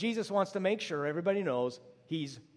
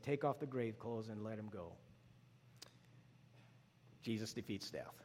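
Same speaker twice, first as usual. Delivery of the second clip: much lower and softer, awed; hushed; 8 semitones lower, 12 dB quieter than usual. Real, not acted. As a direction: sink into a reverent hush. Quiet climax after the miracle.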